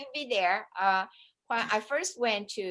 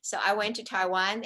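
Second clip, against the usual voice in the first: usual speaking voice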